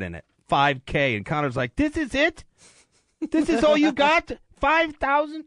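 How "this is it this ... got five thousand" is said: high Irish accent impression, mocking; imitating an Irish fighter sneering at the cash; Conor McGregor impression. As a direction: do a cocky Irish brawler, pitched way up. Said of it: "I can't do Conor McGregor without cussing".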